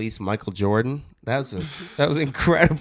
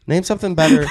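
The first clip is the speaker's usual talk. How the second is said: laughing; 8 semitones higher, 6 dB louder than usual. Real, not acted. Laughing over the lamb chop joke.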